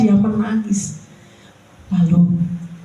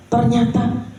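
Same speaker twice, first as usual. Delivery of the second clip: ordinary delivery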